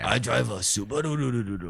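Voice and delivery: slow mock Japanese accent. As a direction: drag it out in an imitation Japanese accent. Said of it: "Chinese and Japanese accents there".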